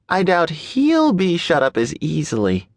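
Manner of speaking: ordinary delivery, nothing marked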